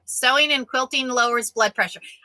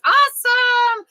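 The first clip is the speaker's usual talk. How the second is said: much higher and louder, stretched out slow; delighted exclaim; 13 semitones higher, 6 dB louder, slower than usual. Real, not acted. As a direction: gush over the compliment. Delighted reaction to viewer comment.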